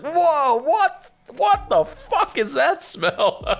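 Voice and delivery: very high mock horror; imitating appalled guests, slow falsetto; retold shocked friends. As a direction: recoil in drawn-out, hammed-up disgust. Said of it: "everybody just goes like".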